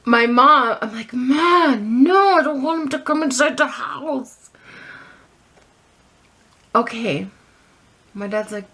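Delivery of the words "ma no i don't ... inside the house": high, pleading whine to mom; whiny protest. Retold or imitated. Daughter begs mom not to let date inside, whiny protest. Retold protest to her mother.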